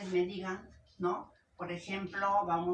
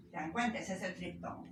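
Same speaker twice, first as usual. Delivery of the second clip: ordinary talk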